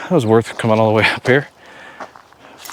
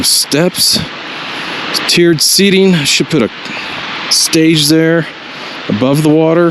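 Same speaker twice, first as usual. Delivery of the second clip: excited pitch; 6 semitones higher, slower than usual. Real, not acted. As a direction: sell the idea with rising excitement. Excited about a concert venue.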